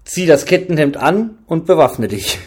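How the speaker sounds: loud, curt command to arm; curt order. A narrator's reading. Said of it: "sagte er kurz angebunden". (laut und kurz angebunden befohlen)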